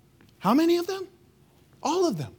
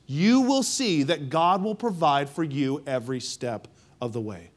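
ordinary talk, nothing marked

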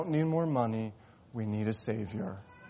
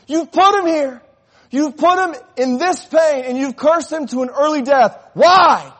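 furious, much higher and louder cry at God; angry shout; 17 dB louder than usual. Acted. Grieving surgeon shouting at God in anger. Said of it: "the doctor shouted".

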